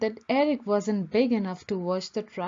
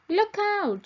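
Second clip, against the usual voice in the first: high, loud warning shout; 10 semitones higher, 4 dB louder than usual. A narrator's reading. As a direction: call out to warn everyone. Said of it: "shouted a mechanic".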